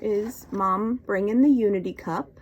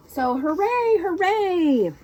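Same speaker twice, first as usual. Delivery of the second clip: put-on higher, bright cheer for the kids; cheerful hooray; 10 semitones higher, 4 dB louder than usual. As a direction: cheer it up for little listeners. Reads excited line to children.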